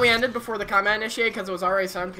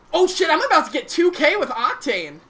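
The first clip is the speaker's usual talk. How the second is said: higher, louder hyped exclamation; excited; 8 semitones higher, 6 dB louder than usual. Real, not acted. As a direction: blurt it out thrilled. About to get two K.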